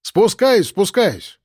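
high called-out assurance; calling back. Acted. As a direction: answer hurriedly from up the stairs. Grandson calls back down the stairs.

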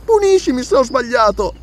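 put-on high-pitched pleading girl; menina arrependida. A narrator's reading. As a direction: own up in a small high child's voice. Girl confesses to her guardian.

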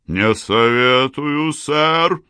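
slow, loud officer's order; commanding voice. A narrator's reading. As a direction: command it like a colonel. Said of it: "командирским голосом".

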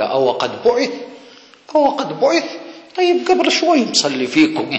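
high, loud comic astonishment; mock incredulity. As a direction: act baffled, pitch leaping high in disbelief. يمثل حوار موسى المستغرب ساخرًا. (استغراب ساخر بصوت عالٍ)

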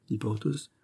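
plain reading voice

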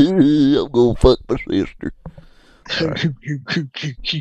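mock Southern drawl, high and loud; hillbilly parody. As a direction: ham up a hillbilly voice.

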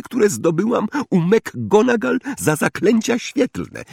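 high-pitched indignant scolding; furious goose-like hissing. A narrator's reading. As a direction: scold them in a high, fussy voice. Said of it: "sycząc na nich jak rozwścieczona gęś".

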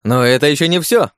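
loud, high showman call; booming circus ringmaster. Acted. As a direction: play the MC to the crowd. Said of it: "вошёл в роль ... как конферансье".

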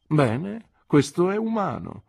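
a hint of an imperious old lady softening; approving stern aunt. A narrator's reading. Aunt approving Peggotty's new name, lightly voiced and brisk. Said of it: "disse mia zia".

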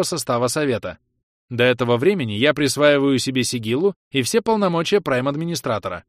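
an AI broadcasts to the whole colony; machine announcement. Acted. The colony AI announces to all colonists, loud and machine-even. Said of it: "Ровный бесполый голос машины".

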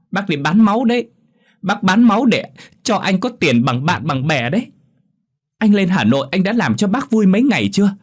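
tearful, high and loud scolding; sobbing reproach. A narrator's reading. Niece to cousin who abused his mother, sobbing loud reproach. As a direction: cry out the accusation. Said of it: "Thu nức nở".

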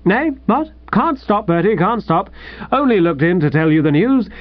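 high, lilting, bubbling announcement; exuberant. Acted. Newly engaged man tells his friend the news, exuberantly. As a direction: gush the news in a giddy rush of happiness. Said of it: "like an exuberant snipe".

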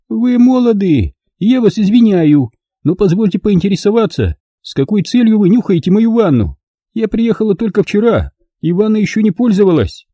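put-on high, proud elderly noblewoman; old lady voice. A narrator's reading. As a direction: speak as a prim dignified old lady.

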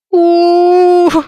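high, loud wailing howl; howling sob. Acted. Heroine collapses on the floor, howling in loud sobs. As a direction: wail it out like a sobbing child. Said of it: "подвывала я, громко всхлипывая".

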